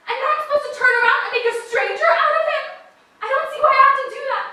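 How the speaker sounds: pained, loud protest; upset protest. Acted. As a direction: push back hard.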